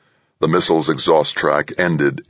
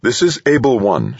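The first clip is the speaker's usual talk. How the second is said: higher, controlled radio call; forced steadiness; 8 semitones higher, 3 dB louder than usual. A narrator's reading. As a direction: work to keep the voice steady. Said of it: "working to keep her voice steady".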